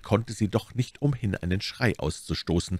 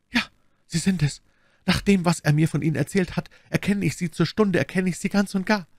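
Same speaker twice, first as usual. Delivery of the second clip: higher, effusive endearments; Milady feigning affection; 11 semitones higher than usual. Acted. Milady greets Constanze with hidden hatred, sweetly effusive. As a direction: gush over a newfound friend.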